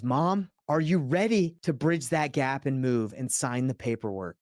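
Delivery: slow, drawn-out plea to his mother, each word weighed; slow urging son. Retold or imitated. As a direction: lean on it slowly, coaxing mom to commit.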